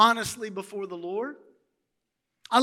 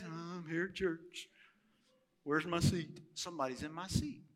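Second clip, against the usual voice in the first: lightly voiced grumbling churchgoer; mock the sulky pew-sitter, quietly; a hint of a sad-faced member; mopey churchgoer; 6 semitones lower, 16 dB quieter than usual.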